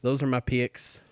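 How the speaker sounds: slow Southern drawl; Southern accent. Acted. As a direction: lay on the Carolina Southern accent. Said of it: "I feel like my accent was pretty good".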